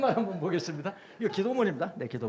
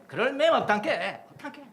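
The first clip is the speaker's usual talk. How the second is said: Jeolla dialect; 8 semitones higher, 7 dB louder than usual. Shown as a demonstration. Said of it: "전라도 말은 맴".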